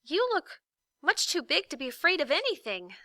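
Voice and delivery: put-on high, hurried boy's voice; quick flattery. A narrator's reading. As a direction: talk fast before he eats you. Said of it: "said Milo quickly".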